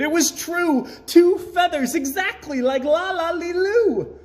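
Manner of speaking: high, loud delighted cheer. A narrator's reading. Gertrude cheering at her new feathers, high and loud. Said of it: "and she cheered".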